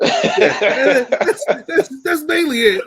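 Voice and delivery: high laughing words about the wings. Real, not acted. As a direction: laugh through the answer.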